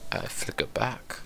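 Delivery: put-on hushed British accent; soft, fancy British poem ending. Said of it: "That was a great British accent".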